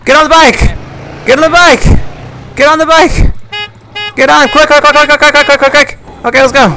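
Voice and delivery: high, frantic hurry-up shouts; urgent. Real, not acted. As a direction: rush him onto the bike. Urging partner onto bike.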